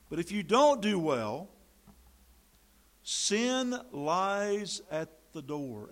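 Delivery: loud, slow preached warning; forceful warning. Real, not acted. Preacher booming God's warning to Cain, loud and slow. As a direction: thunder it out from the pulpit.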